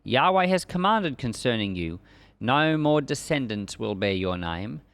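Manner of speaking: ordinary delivery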